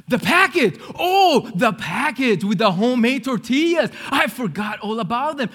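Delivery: loud, high burst of dawning horror; sudden realisation. Acted. Nephew remembering grandma's forgotten tortillas, loud alarmed realisation. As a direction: cry out as it hits you.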